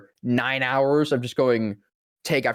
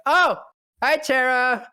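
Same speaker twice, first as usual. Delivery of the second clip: surprised greeting; 12 semitones higher, slower than usual. Retold or imitated. Surprised greeting to friend Tara.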